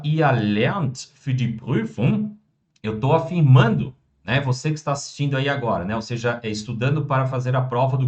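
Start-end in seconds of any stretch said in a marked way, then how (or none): 0.0-2.4 very slow, every German word laid out clearly; slow model sentence. Shown as a demonstration. Teacher modelling German for students, very slow and clear. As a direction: spell the sentence out slowly for the class. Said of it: "Quando eu digo".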